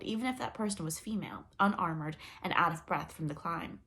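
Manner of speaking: ordinary delivery, nothing marked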